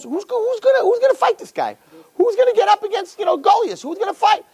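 high-pitched mock fretting crowd; mock panic. Retold panicking crowd of soldiers.

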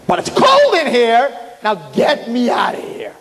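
high, drawn-out bellowed complaint; exasperated shout. Retold or imitated. Man stuck in a fridge demanding release, exasperated shout. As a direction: shout high, stretching the key words.

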